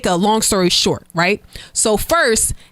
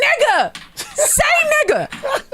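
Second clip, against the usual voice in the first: high, drawn-out cry; exasperated exclamation; much slower than usual. Real, not acted. Confirming to co-host it is that man, exasperated high cry. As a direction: stretch it out, pitched way up.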